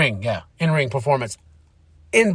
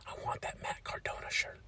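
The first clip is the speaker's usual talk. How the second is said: hushed confiding whisper, almost no voice; 22 dB quieter than usual. Real, not acted. Telling viewers he wants the shirt, whispered. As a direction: lean in and whisper it like a secret.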